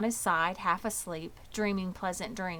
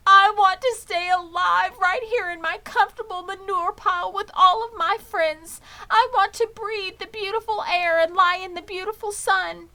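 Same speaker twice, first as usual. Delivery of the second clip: high, loud weepy plea; tearful pleading; 12 semitones higher, 10 dB louder than usual. Acted. Wilbur weeps about staying alive, wailing high and loud. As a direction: wail about all you'll lose. Pig weeping about life.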